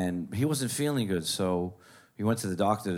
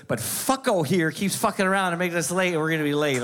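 high, louder accusing mother voice; nagging mom; 8 semitones higher, 4 dB louder than usual. Retold or imitated. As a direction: jab the blame at dad like a cranky mom. Mom blaming dad, finger gun.

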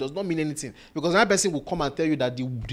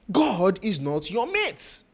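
slow rebuke; 6 semitones higher, much slower than usual. Real, not acted. Preacher rebukes.

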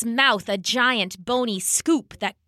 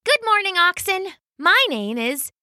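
put-on bright young woman; 8 semitones higher than usual. Kaya greets oxen brightly.

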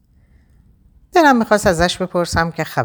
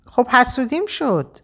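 higher, pitiful little admission; sheepish, innocent; 10 semitones higher than usual. Acted. Raha owning up to jealousy with a pitiful innocent tone. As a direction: play the wronged innocent, pleading. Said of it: "رها مظلوم شد و گفت".

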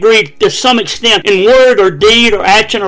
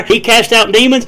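ordinary delivery

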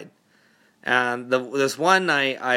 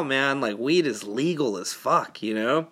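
ordinary talk

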